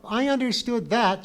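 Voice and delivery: high, loud fierce outburst. A narrator's reading. Pee-wee insulted by the Roman, fierce. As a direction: burst out fiercely at full voice. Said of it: "she said fiercely".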